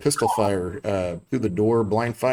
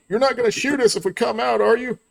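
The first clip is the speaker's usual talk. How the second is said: high-pitched, wary surrender question; pleading councilman; 13 semitones higher than usual. Acted. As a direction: voice a councilman checking it's safe, pitched high. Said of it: "you hear the councilman".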